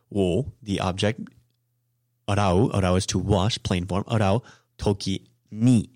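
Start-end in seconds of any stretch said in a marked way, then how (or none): 4.8-5.9 "toki ni" slow with stress on "ni"; slow emphasis. Shown as a demonstration. Teacher spotlights the particle for learners, slowly. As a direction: land "toki ni" slowly, leaning on "ni". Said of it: "adding the emphasis".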